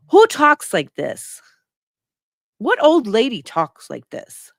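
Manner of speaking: high, loud and slow, baffled outrage; incredulous outburst. Real, not acted. Host venting at the mother's wording, loud and disbelieving. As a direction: demand who would ever say this.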